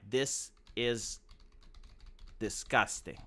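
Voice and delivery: slow, soft, word by word; deliberate typing. Acted. Creator typing a caption for the thumbnail, deliberate. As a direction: spell it out softly as if typing, pausing midway.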